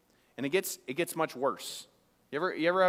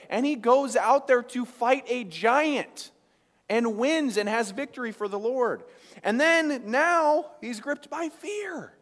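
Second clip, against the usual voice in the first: loud, high, slow preacher's build; fiery preaching; 8 semitones higher, 6 dB louder, slower than usual. Real, not acted. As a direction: preach it out with full force. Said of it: "preaching climax".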